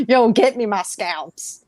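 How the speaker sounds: loud, slow mock commander; movie soldier parody. Riffing on Inglourious Bastards in space, hammed-up order. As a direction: draw out the order with playful force. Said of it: "Inglourious Bastards in space".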